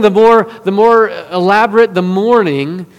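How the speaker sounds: ordinary talk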